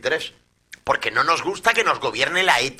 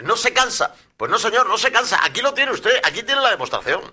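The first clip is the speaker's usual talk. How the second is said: high-pitched indignant address; indignant rhetorical challenge; 8 semitones higher than usual. Acted. Pundit addressing an absent politician over public mockery, indignant challenge. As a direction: challenge them, pitched right up.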